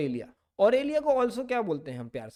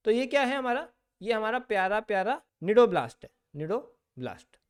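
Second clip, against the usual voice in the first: ordinary talk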